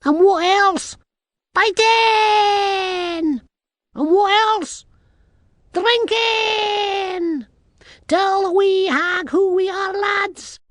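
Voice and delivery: very high, loud little-man voice; rally the lads in a piping Scots voice; boisterous tiny Scottish man. A narrator's reading.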